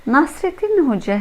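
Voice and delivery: ordinary delivery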